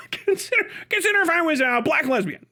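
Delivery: high, laughing mock excuse-maker; laughing mock plea. Bouncer mocking a patron's excuse, laughing and high.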